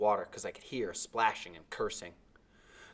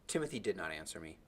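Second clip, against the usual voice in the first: ordinary delivery, nothing marked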